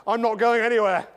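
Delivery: loud mock-English refusal with a scoffing laugh; reluctant English audience. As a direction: refuse as a stubborn English crowd would. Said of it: "If I said that to a group of English people".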